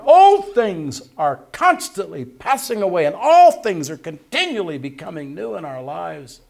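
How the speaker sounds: loud, high, slow preaching of scripture; full-force preaching. Real, not acted. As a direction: proclaim it to the congregation.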